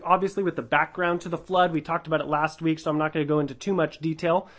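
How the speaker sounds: ordinary talk, nothing marked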